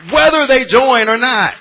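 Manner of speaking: loud, high, ringing pulpit preaching; preaching at full force. Acted. Pastor preaching to his church, full force. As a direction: drive it home to the pews, full voice.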